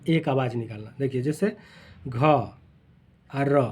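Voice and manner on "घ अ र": घ अ र said very slowly, each sound apart; sounded out letter by letter. Shown as a demonstration. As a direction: spell the word out one sound at a time. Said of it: "अलग अलग उच्चारण किए".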